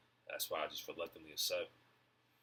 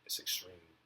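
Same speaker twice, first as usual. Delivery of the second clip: whisper; slower than usual. Shown as a demonstration. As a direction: whisper "It's extreme" under the breath. Repeats the phrase, then whispers it.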